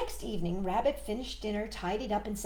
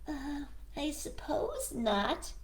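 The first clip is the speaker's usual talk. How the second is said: slow, hesitant, lightly voiced rabbit; a polite rabbit giving in unwillingly; reluctant Rabbit; 6 semitones higher, 4 dB quieter, slower than usual. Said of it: "said Rabbit".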